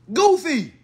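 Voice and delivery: "Goofy" jumps up high and scornful. Shown as a demonstration.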